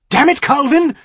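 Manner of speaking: loud, high exasperated exclamation; sharper voice. Acted. Earl loses patience with his son-in-law, sharp and loud. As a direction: burst out sharply, pitch jumping up. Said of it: "his voice sharper than it had been".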